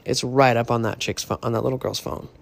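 normal talking voice

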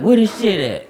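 high, loud granny impression; imitated granny outburst; demanding granny. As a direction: demand it as an irate grandmother.

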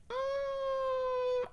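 long, high-pitched "mm"; drawn-out high hum of doubt; high uneasy hum. Retold or imitated. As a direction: hold a thin, high hum in mock unease. Awkward reaction to train memories.

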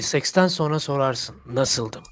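ad voiceover. Pretend. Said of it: "Bak, en son".

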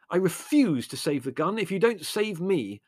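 high-pitched, defiant yell; indignant cry, only moderately loud. A narrator's reading. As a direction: yell a refusal. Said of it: "Larry yelled".

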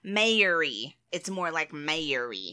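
slow Texas drawl; MARY drawn out as "may-er-ee" in a Texas accent. Shown as a demonstration. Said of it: "that's more like a Texas accent".

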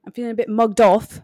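mock-offended British reality-show voice; British Love Island accent. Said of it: "my British accent is watching Love Island".

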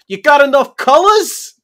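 put-on loud, high, slow heckle in Boston accent; Boston Teamster. Teamster heckles brightly dressed passerby, loud Boston accent. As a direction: holler it like a heckling Boston worker. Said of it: "some Teamster sitting at a half set up booth".

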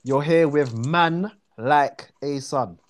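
slow South London slang demo; teaching London slang slowly. Shown as a demonstration. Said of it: "we would say".

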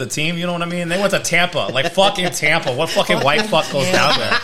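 loud Boston sports-fan rant; Boston accent. Pretend. Ranting to friends about the Patriots, in a loud Boston accent. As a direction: bellow it like a wicked Boston fan. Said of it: "I bet the accent changed".

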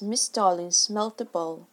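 ordinary delivery

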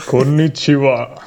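slow, drawn-out mock Japanese greeting; fake Japanese accent. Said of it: "why are you going Japanese".

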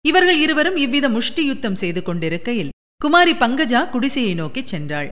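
ordinary delivery

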